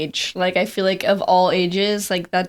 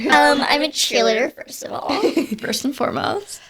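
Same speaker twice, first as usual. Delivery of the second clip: bubbly, higher cheerleader voice; 7 semitones higher than usual. Retold or imitated. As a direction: mimic a peppy cheerleader. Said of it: "I hated the way you said that".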